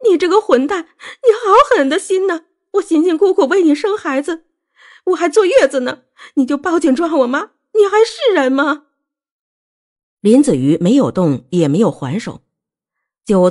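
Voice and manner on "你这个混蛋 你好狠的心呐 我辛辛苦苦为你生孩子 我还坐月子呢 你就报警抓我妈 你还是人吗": very high-pitched shrieking accusation; high, frantic outburst; hysterical screaming. A narrator's reading. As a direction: shriek at him as if breaking down. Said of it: "尖叫着冲上去打林子瑜".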